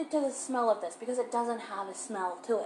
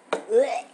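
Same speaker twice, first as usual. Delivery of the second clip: gagging at smell; 12 dB louder than usual. Real, not acted. Retching at horrible smell.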